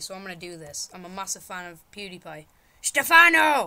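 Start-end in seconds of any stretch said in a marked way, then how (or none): 2.8-3.7 loud, high holler of a name; calling out. Pretend. Player calls out for Stefano in the game, loud holler. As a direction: yell out for someone missing.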